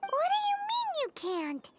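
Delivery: high, disbelieving question; can't believe they'd refuse; incredulous. Acted. Paimon questioning the author's refusal, incredulous.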